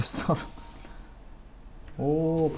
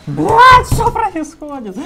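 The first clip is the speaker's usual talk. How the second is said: shrieking high in alarm; panicked shriek; 16 dB louder, slower than usual. Real, not acted. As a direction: scream it in panic. Sudden chaos in a fight.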